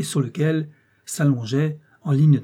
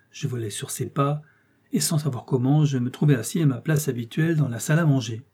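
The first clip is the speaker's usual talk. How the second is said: ordinary delivery, nothing marked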